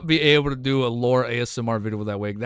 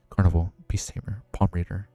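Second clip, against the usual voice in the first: lowered, voiced reading; low ASMR talking voice; 7 semitones lower than usual. Shown as a demonstration. Streamer asking viewers to pick a style, low-voiced. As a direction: read the list in a low speaking voice. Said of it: "do I speak like this?".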